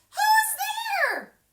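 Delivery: very high, loud squeaky cry; high mouse shout. Acted. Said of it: "Mouse cries".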